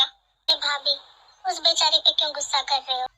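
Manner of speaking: ordinary delivery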